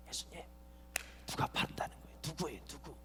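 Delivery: very soft, confidential acted whisper; secretive whisper. Preacher voices John asking Jesus in his bosom, secretive whisper. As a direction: whisper it privately, as if nobody should overhear.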